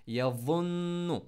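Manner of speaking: यज़ुन्नु slow, the व heavy and the न्न nasal; full recitation. Shown as a demonstration. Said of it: "soft और मोटा". (यज़ुन्नु धीरे, वाव मोटा)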